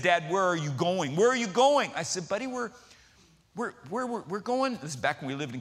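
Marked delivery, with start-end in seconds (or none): 1.1-1.9 higher, louder repeated question; insistent child. Retold or imitated. 2.3-4.8 halting, softer and pitched up; stalling dad. Retold or imitated. Dad stalls before answering son.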